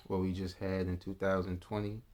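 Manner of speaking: normal talking voice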